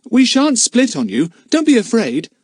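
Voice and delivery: put-on boy explaining to the smugglers; boy's voice. A narrator's reading. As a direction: answer like an eager schoolboy. Philip reassures the men.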